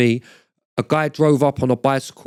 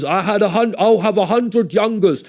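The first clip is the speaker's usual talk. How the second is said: higher, lightly put-on swagger; 7 semitones higher than usual. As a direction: boast like the rapper bragging. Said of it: "you know when [name] goes".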